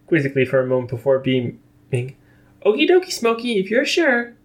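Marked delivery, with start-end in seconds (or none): 2.7-4.3 very high, bright and bouncy; sing-song cheerful pony; cheerful Pinkie Pie. A narrator's reading.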